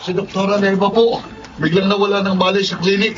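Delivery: panicked report. Acted. Nurse reports doctor collapsed.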